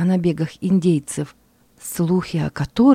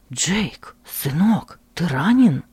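scared, breathless question; out of breath; slower than usual. Acted. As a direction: gasp it out, barely breathing. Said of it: "с трудом дыша спросил испуганный отец".

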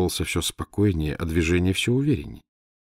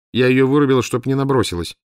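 put-on high, loud blunt girl's voice; matter-of-fact boast; brash assistant; 6 semitones higher, 5 dB louder than usual. A narrator's reading. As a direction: Valya bluntly justifying the knockout. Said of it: "сказала Валя".

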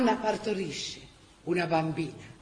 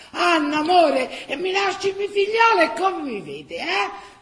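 pleading shout; 7 semitones higher, 7 dB louder than usual. Acted. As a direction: beg her not to leave him. Husband pleading with wife.

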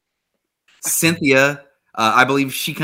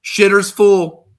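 slow, raised quote voice; drawn-out, higher delivery; quoted answer; 7 semitones higher, 6 dB louder, much slower than usual. Acted. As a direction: draw it out, pitched up, like announcing a punchline. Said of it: "He says".

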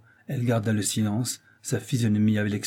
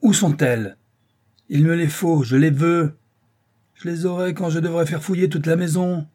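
angry interrogation; 7 semitones higher, 6 dB louder than usual. Acted. Father demanding the letters.

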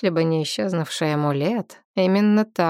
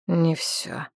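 gloomy objection; 4 dB quieter than usual. A narrator's reading. Nakht objects to a companion's question, gloomily. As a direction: object gloomily, a little softer. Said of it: "мрачно возразил Нахт".

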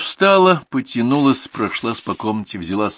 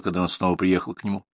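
ordinary delivery

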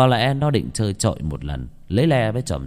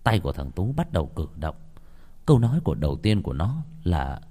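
ordinary delivery

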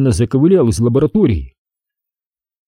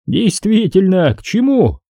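drawled; 9 semitones higher than usual. Acted. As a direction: stretch the words out mockingly. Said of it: "протянул Рамос".

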